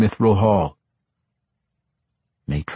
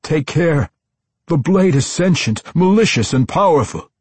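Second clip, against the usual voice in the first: urgent high, louder warning cry; 9 semitones higher, 5 dB louder than usual. A narrator's reading. Tosin warns them about the sentient blade, crying out. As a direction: call out a warning in a raised, high voice. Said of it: "Tosin cried out again".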